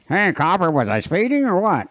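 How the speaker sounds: high, teasing mock country voice; playing dumb with a twang; country drawl to a cop. Joking to a pulled-over cop, in a high mock country voice. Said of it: "use that voice".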